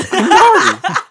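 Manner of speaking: a bright shriek of laughter; high loud laugh. Real, not acted. Laughs at Marty mix-up.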